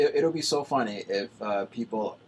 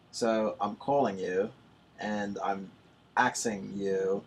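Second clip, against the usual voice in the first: attempted British accent; 9 dB louder than usual. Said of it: "this may sound a little Australian".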